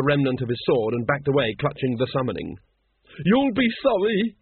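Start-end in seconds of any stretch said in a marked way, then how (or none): 3.2-4.4 slow, high-pitched vengeful threat; hissed threat. A narrator's reading. Once threatens the watchmen as he backs away, hissing. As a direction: draw out the threat, pitch well up. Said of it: "he hissed".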